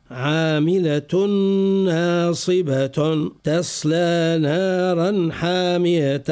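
slow recitation of عاملة ناصبة ending on the vowel; mistaken vowel stop. Shown as a demonstration. Teacher imitating videos that teach the wrong stop. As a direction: recite slowly with the endings still voiced. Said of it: "يعلم الناس هكذا".

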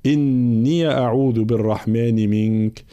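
slow إني with the ya voweled as niya; slow إنيَ أعوذ; ya with fatha. Shown as a demonstration. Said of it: "يحرك هنا الياء بالفتحة".

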